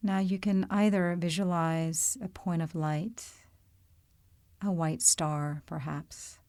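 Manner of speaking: calm, unhurried meditation voice with long pauses; guided meditation. Real, not acted. Yoga teacher leading listeners into body awareness, calm guided-meditation delivery. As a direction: guide them gently through the body, leaving space between phrases.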